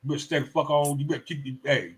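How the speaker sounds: ordinary talk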